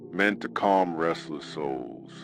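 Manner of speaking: usual speaking voice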